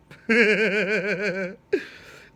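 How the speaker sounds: loud, high hearty laughter; big laugh. Real, not acted. Cracking up after his comeback, laughing loudly.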